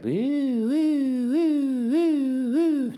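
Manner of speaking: high, drawn-out mock howling; dog howl. Imitating the neighbor's dog howling all day, high and drawn out. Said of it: "That's my dog howl".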